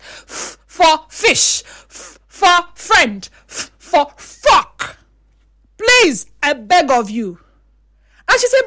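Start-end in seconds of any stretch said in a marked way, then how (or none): 0.0-5.0 slow drilled "F for fish", "F for friend", "F for fuck", each F stressed; full chest phonics. Shown as a demonstration. A mother showing teachers how to drill the F sound. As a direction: drill the letter like a teacher, slowly. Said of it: "say it with your full chest".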